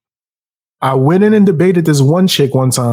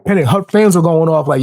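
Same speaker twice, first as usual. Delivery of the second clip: ordinary talk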